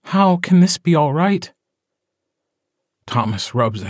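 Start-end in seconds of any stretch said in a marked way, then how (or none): 0.1-1.5 a pleading wife, voiced higher; Lizzie pleading. A narrator's reading. Lizzie pleading with Thomas, voiced higher and pained. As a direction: ask it as the distraught mate.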